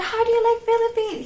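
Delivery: high, eager mock question; excited question. Stranger excitedly asks the visitor, high imitated eagerness. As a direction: ask it like an overexcited stranger. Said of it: "He was really excited".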